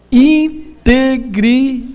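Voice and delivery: very slow, drawn-out integridade; slow emphasised integridade; stretched key word. Acted.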